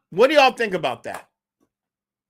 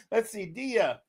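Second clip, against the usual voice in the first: usual speaking voice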